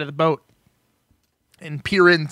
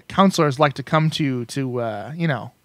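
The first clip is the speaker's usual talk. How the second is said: ordinary talk, nothing marked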